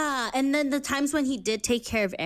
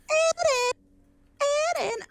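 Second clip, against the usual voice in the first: much higher, pleading calls of the name; mock Mikasa calling. Mocking how Mikasa always calls for Eren, high and whiny. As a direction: whine his name like a clingy friend. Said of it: "She's like".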